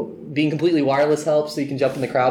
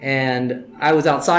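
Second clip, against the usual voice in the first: normal talking voice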